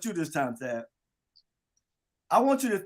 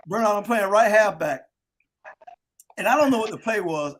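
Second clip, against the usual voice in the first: ordinary delivery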